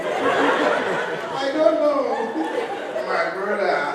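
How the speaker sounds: drawn-out mock Nigerian reply; exaggerated Nigerian English; Nigerian accent. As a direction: stretch it in a put-on Nigerian accent. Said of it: "with a Nigerian tourist".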